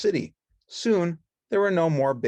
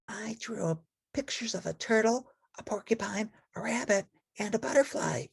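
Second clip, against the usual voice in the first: put-on high, soft girlish voice; Betty Lou voice; 12 semitones higher, 3 dB quieter than usual. Betty Lou pointing to the bird, soft and high.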